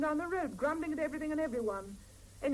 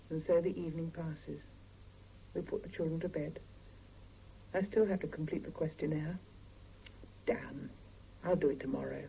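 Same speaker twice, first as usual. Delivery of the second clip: low, quiet narrating voice; story narration; 8 semitones lower, 6 dB quieter than usual. A narrator's reading. Wife recounting the evening, low quiet narration. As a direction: tell it low and tired.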